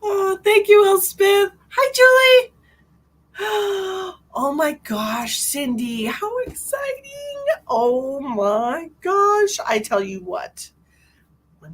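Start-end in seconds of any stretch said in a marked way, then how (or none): 0.1-2.5 high, loud, delighted greeting; excited thanks. Real, not acted. 3.4-9.6 slow, drawn-out, awed delight; thrilled reaction. Real, not acted. Reacting to viewer's exciting news.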